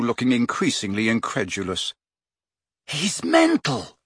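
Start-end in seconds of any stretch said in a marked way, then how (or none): 2.9-4.0 high, slow indignation; furious. A narrator's reading. Boy reacts to the burial invitation, indignantly furious. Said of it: "he said furiously".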